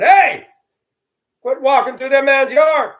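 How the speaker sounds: high, loud yell across the yard; yelling at teens cutting through the old man's yard; shouting at teens. Retold or imitated. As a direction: call out to chase them off.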